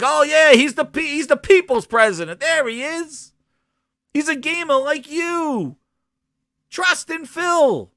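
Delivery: high-pitched sarcastic gushing; mock fan adoration. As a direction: imitate a devoted fan hailing a hero. Mimics adoring fans of Phil.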